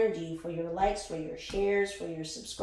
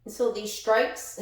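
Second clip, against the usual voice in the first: ordinary talk, nothing marked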